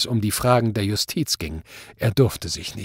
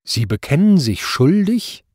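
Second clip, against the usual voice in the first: higher, rising in disbelief; stunned courtroom question; incredulous question; 6 semitones higher, 4 dB louder than usual. A narrator's reading. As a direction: ask as if you can't believe your ears. Judge incredulous at guilty plea.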